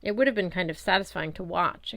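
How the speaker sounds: usual speaking voice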